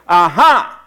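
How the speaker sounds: loud gloating Aha; spring out and crow it; triumphant mock gotcha. Atheist neighbor leaping from the hedges, loud gloating gotcha.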